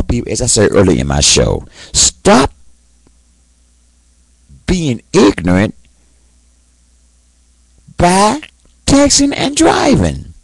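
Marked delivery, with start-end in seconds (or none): 1.9-10.3 high, heated scolding; angry rant. Real, not acted. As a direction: scold them with real anger. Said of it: "and I am angry".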